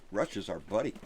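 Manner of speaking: usual speaking voice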